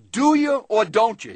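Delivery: loud, high, curt demand from the back; impatient yell. Retold or imitated. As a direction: snap at the customer. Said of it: "yelling from the back".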